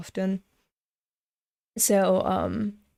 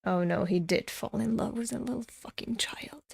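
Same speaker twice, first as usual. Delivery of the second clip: whispered in disbelief; a disgusted whisper at the page; horrified whisper; 8 dB quieter than usual. Real, not acted. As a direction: hiss it out, appalled. Horrified reaction while reading.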